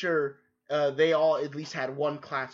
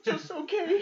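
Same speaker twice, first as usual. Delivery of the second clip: mock high squeak; 13 semitones higher, slower than usual. Mocking her lukewarm answer.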